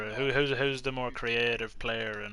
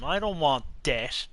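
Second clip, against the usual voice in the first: ordinary talk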